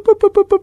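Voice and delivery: squeaky high boop noises for the dying TV. Retold or imitated. Telling the crowd how the TV kept dying, high imitated boops. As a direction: mimic the picture shrinking with high beeps. TV picture shrinking to a dot.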